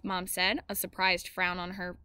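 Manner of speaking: ordinary delivery, nothing marked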